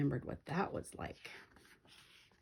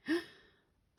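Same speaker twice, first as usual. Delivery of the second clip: frightened gasp; 12 semitones higher than usual. A narrator's reading. Twins frightened.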